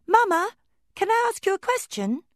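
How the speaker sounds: very high, loud call. Acted. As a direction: call out across the house. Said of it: "she called out".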